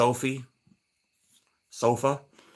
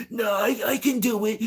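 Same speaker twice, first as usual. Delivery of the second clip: imitating breathlessness; 11 semitones higher than usual. Old lady insisting on yard work, mimicked gasping for breath. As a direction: wheeze and gasp in exaggerated mimicry. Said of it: "She can barely breathe".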